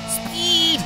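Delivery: high-pitched drawn-out cry; excited exclamation. Real, not acted. Streamer thrilled by how fast the car goes, high excited cry.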